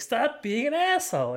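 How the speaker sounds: a hammed-up girl telling him off; mock scolding girl. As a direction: snap at him like an annoyed teen. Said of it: "she's like".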